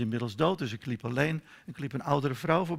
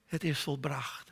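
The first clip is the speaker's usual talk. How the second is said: slowed, voiced, measured words; slow, deliberate repeat; 6 dB quieter, slower than usual. Real, not acted. Preacher repeating Jesus's words to God, slow and solemn. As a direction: let each word land slowly.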